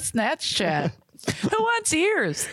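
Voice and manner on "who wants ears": mock barker. Mock carnival call.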